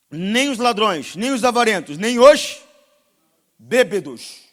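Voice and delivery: full-force sermon roar; fiery preaching. Acted. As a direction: hammer each sin from the pulpit.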